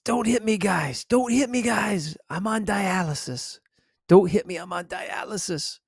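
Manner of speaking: high, strained cry at held-back volume; mock yelled plea. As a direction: imitate a panicked man begging not to be hit. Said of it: "and just yells".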